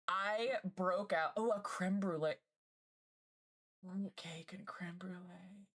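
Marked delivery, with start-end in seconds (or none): 4.1-5.6 hushed, slow whisper. Real, not acted. Streamer savouring a dessert post, slow whisper. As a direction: whisper it slowly, drawn out. Reading a dessert aloud, awed.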